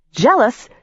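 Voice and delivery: sharp, high bark of disbelief; incredulous bark. A narrator's reading. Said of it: "I barked".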